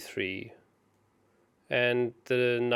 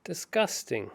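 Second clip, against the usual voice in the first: disgusted; 6 semitones higher, 5 dB louder, much slower than usual. Real, not acted. Reacts to opponent's clever move.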